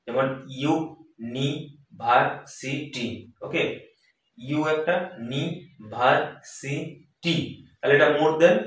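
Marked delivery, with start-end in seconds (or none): 0.5-3.3 "university" very slowly, a gap after each syllable. Shown as a demonstration. Teacher splits example word into syllables. 4.4-7.6 slow, separated syllables of "নিভার্সিটি" after "ইউ একটা"; counting out syllables. Shown as a demonstration. Teacher counts out the word's syllables.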